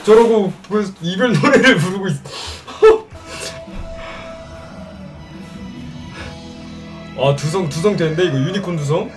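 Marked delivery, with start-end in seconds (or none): 2.2-7.1 trying not to laugh. Real, not acted. Laughing at wedding song.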